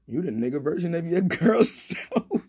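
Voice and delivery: retold tease dissolving into a laugh; laughing punchline. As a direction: crack up on the last words. Punchline told through laughter.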